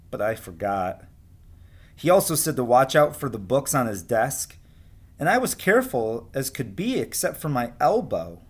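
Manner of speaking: lively picture-book read-aloud; storybook voice for young kids; reading to children. A narrator's reading. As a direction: read the story to your class with expression. Teacher reads picture book aloud.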